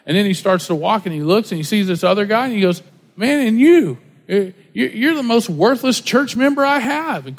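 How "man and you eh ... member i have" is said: imitated public scolding, higher and louder on the first words; berating pastor. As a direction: mimic a pastor calling someone out, pitched up.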